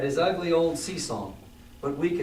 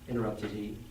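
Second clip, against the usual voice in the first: ordinary delivery, nothing marked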